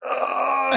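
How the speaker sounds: high, strained "ugh"; mock pained groan. Actor holding the scene while burning, mock pained groan. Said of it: "he's laying there like".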